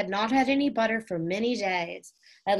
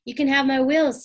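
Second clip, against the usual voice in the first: higher and louder, keen; eager offer; 6 semitones higher, 6 dB louder than usual. A narrator's reading. Little Benny offers his wheels to Henry, high and loud. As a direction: jump in to help.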